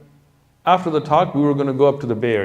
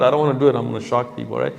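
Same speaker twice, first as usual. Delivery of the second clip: ordinary talk, nothing marked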